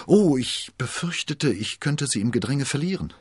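high, fearful excuse; frightened queen making excuses; trembling voice. A narrator's reading. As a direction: answer timidly in a quavering high voice. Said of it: "Mit bebender Stimme".

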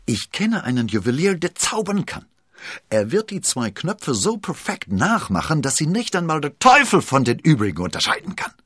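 high-pitched, eager excitement; thrilled by a brilliant plan; excited. A narrator's reading. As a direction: build to a loud, triumphant finish. Duke excitedly unveils his saving idea.

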